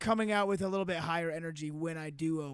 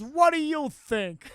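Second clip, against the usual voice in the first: slow, loud, higher comeback; mock indignant retort; 8 semitones higher, 7 dB louder, slower than usual. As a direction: drag out the sarcastic question loudly. Repeats the movie retort.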